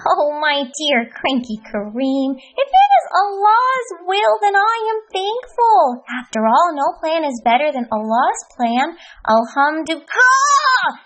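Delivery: high, kindly, unbothered; patient Happy Hamdi. Acted. Hamdi gently answering cranky Kareem, bright patient voice.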